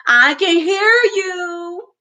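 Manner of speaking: put-on a child hollering back at the boys. A narrator's reading. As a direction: call it out across the street, slow. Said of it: "I hollered back".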